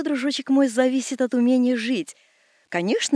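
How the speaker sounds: usual speaking voice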